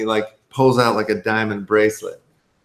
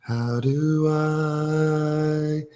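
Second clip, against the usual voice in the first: very slow, higher and drawn out; mock dying mother; 5 semitones higher, much slower than usual. Retelling the mother's deathbed line, very slow and higher. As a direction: stretch it like a fading farewell.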